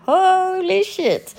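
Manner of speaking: high, drawn-out holy shit; mock-horrified exclamation at the drunk crowd; shocked exclaim. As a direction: gasp it out in slow, stretched disbelief. Dramatic reaction to drunk tourists.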